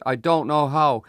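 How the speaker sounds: plain reading voice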